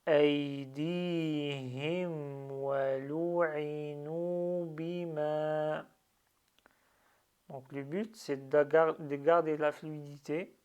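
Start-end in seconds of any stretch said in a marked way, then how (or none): 0.1-5.9 slower still, each word held long; very slow recitation. Shown as a demonstration. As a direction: recite it even more slowly, word by word. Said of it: "Je vais aller moins vite". (récitation encore plus lente, mot à mot)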